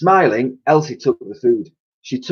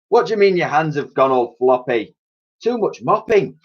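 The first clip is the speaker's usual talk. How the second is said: higher blustering guard and cheeky cleaner; character voices; 9 semitones higher than usual. Acted. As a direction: boss orders, cleaner fibs back. Argument Clout and Dotty.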